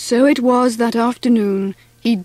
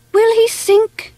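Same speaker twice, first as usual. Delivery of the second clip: high-pitched, half-whispered worry; worried mock whisper; 11 semitones higher than usual. A narrator's reading. Lucy fretting over Mervyn in the water, worried. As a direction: ask it high and anxious, not truly whispered. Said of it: "she whispered".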